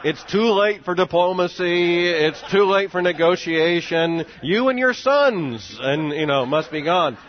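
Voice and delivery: slow, loud, high mock proclamation; mock ultimatum. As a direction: declare it like a stern head of state, drawn out. Bush-style ultimatum to Saddam, mimicked.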